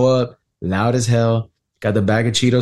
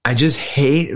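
ordinary talk, nothing marked

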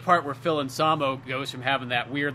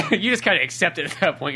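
ordinary talk

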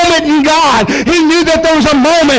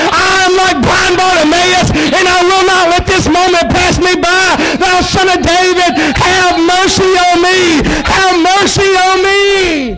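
slow, higher shouted plea to David's son; the congregation's plea, preached at full force; 5 semitones higher than usual. Pretend. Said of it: "somebody has to say".

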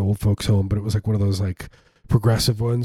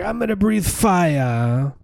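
high, slow mock artist; 8 semitones higher, much slower than usual. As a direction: sneer it like a pretentious artist. Mocking circus artists.

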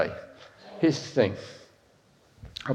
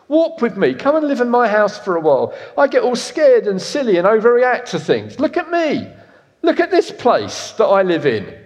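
high, loud hammed-up house character; silly wobbly house; 8 semitones higher, 5 dB louder than usual. Preacher voices a nervous house talking to the congregation, high and hammed-up. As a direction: play the house, much higher and louder, fussing and showing off.

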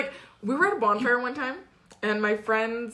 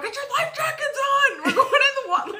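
mock alarm shout; 13 semitones higher, faster than usual. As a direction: yell orders to everyone, laughing. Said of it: "start yelling at everyone".

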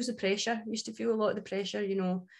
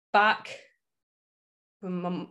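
usual speaking voice

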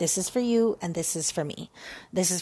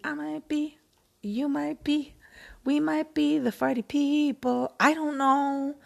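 higher, playful singsong; silly high voice; 8 semitones higher than usual. Real, not acted. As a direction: tease it up high. Playful, high-pitched musing.

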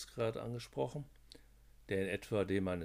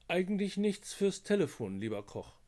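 higher, a touch patronizing; slightly condescending; 11 semitones higher, 5 dB louder than usual. A narrator's reading. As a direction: talk down to him lightly. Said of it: "einen leicht herablassenden Tonfall".

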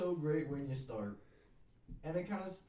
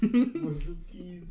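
slow, louder mocking echo; mocking imitation; 2 semitones higher, 5 dB louder, much slower than usual. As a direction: drawl it back to tease. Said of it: "Did you just make fun of me?".

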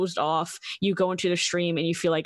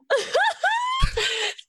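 shrieky, very high laugh; helpless high-pitched cackle; high squealing laugh. Real, not acted. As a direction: burst into a high, almost crying laugh. Laughing at own awkward story.

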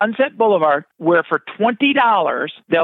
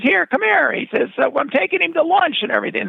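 higher, breezy buddy-buddy voice; imitating Bruno Kirby; 9 semitones higher than usual. Bruno Kirby charming the delivery guy, high breezy voice. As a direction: play the friendly star charming the courier.

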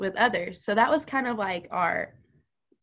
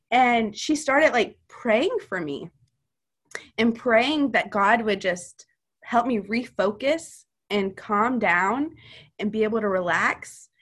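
ordinary delivery

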